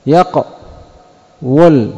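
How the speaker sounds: يقول drawn slowly with the w sounded; heavy original yaqwul. Shown as a demonstration. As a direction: let يقول sound heavy, yaq-wul. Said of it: "ما هو سبب الثقل؟". (يقول بنطق ثقيل: يَقْوُلُ)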